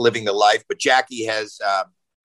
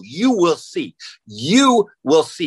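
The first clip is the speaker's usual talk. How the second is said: higher-pitched, drawn-out retold warning; ominous dad warning; 5 semitones higher, 3 dB louder, slower than usual. Livid dad answering his frightened daughter, mock ominous warning. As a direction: stretch it into a mock-stern threat.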